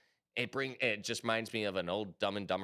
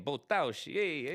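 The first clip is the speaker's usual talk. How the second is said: hammed-up, higher-pitched Italian; mock Italian exclamations; Italian accent; 6 semitones higher than usual. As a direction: ham it up like an Italian. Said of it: "like I was Italian".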